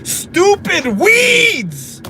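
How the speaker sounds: high, drawn-out mock-furious yell; mad outburst. Parent cursing the whole yard situation, mock fury. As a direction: stretch both words in a frustrated yell. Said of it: "so mad at the".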